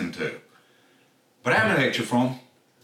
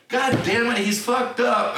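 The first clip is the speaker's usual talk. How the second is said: high-pitched retold exasperation; exasperated outburst; 12 semitones higher, slower than usual. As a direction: re-enact frustration with pitch well up. His exclamation in retold fight.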